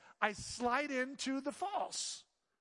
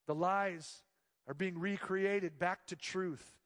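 ordinary delivery

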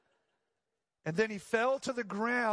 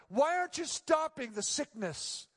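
anguished plea; 8 semitones higher than usual. Retold or imitated. Reenacted prayer of protest to God.

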